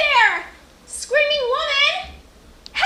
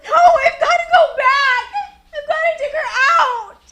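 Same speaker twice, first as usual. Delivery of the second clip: sobbing protest. Acted. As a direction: cry and fight back. Girl refuses to lie down.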